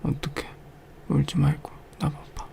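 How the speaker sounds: very soft whisper; hushed whisper. Acted. Whispering to a crying partner, very soft. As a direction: whisper close to calm them.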